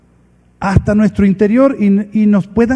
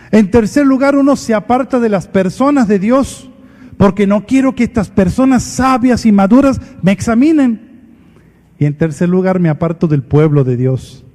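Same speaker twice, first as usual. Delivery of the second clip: ordinary talk, nothing marked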